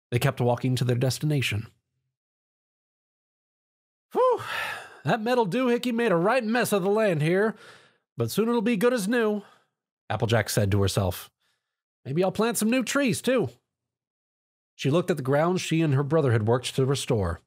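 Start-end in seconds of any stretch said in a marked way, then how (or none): 4.1-13.5 Applejack to herself. Acted.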